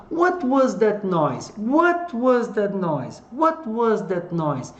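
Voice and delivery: "What" pitched high and drawn out, then a slow fall through "noise"; stress on WHAT. Shown as a demonstration. Teacher models wh-question intonation, stress on "What". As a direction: hit "What" and glide down to the end. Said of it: "a entonação tá nas palavrinhas com W H".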